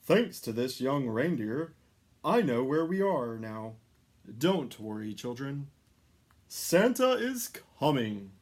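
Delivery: booming Santa voice; jolly Santa. A narrator's reading. Santa reassuring the children aloud, booming. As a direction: call out like a hearty Santa.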